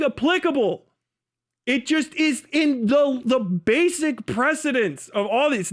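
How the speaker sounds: heated rant. Real, not acted. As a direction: push it up high and hammer each word, exasperated. Ranting emphatically about free speech.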